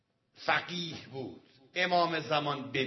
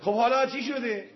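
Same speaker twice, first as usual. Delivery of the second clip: eager question; 8 semitones higher, 7 dB louder than usual. Retold or imitated. Cleric asks again.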